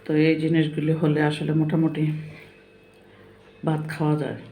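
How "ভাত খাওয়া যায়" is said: talking through a mouthful; mouth full. Real, not acted. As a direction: speak around a bite of rice. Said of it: "আজ খেতে খেতে".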